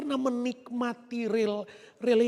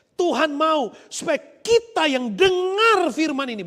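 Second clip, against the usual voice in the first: loud, slower, full-force preaching; preaching shout; 3 semitones higher, 6 dB louder, slower than usual. Real, not acted. Preacher calling out to the congregation, loud. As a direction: drive the word home.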